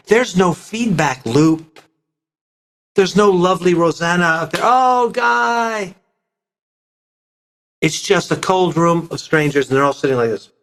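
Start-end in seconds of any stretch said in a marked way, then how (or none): 4.6-5.9 swooning fan. Pretend. Imagined fan calling out.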